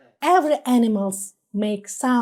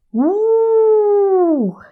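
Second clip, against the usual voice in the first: wolf howl; 7 semitones higher than usual. Shown as a demonstration. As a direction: howl for the child. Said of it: "And the wolf says, how?".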